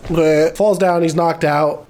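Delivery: normal talking voice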